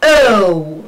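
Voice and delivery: O drawn out as "uh-oo", falling; long model O; diphthong demo. Shown as a demonstration. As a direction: glide O from schwa into oo. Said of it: "We pronounce it as".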